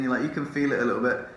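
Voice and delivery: normal talking voice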